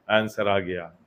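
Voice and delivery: ordinary talk, nothing marked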